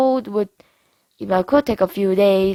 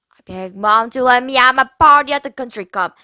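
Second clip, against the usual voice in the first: usual speaking voice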